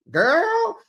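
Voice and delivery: high excited exclamation. Real, not acted. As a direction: squeal it like juicy gossip. Hyping up the recap.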